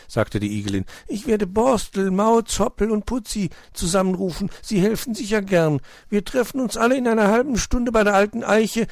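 high, snuffling hedgehog lady. Acted. Frau Igel meldet sich am Telefon, hohe Igelstimme. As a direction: answer the phone with a snuffle.

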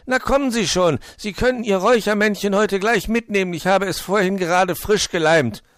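high, loud and wide awake; reply bursting with pep; bright-eyed storybook fox voice; chipper fox. A narrator's reading. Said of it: "erwiderte er putzmunter".

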